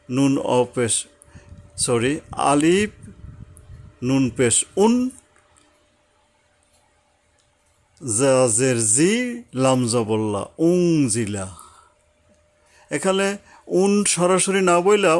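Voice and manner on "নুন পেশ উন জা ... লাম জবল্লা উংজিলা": slow spelling that ends in উংজিলা said as one word; spelling then joined word. Shown as a demonstration. Teacher building a Quran word letter by letter. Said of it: "মিলাইয়া উচ্চারণ করতে হবে".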